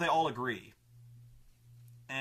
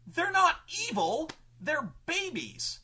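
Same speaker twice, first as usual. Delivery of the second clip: mock pleading objector; 12 semitones higher than usual. The other player defending orc babies, high-pitched protest. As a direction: protest with rising indignation. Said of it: "the guy who argues with him goes".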